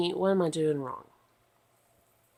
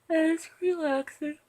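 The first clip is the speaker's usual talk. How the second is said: sing-song; 11 semitones higher, 9 dB louder, slower than usual. Real, not acted.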